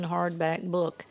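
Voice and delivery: ordinary delivery, nothing marked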